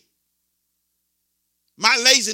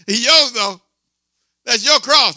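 punchline shout; 4 semitones higher, 7 dB louder than usual. Real, not acted. Punchline after laughter.

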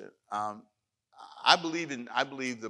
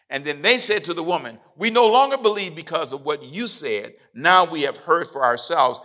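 loud, rising preacher's cadence, louder on the quotes; full-force preaching; 5 semitones higher, 10 dB louder than usual. Acted. Pastor preaching to his congregation, loud and building. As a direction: preach it to the back row, building.

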